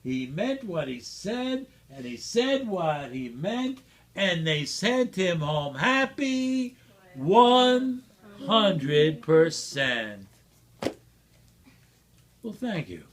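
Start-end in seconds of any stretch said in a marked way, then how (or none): 4.1-10.3 slow, drawn-out closing line; grand finale. A narrator's reading. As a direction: savor the last words.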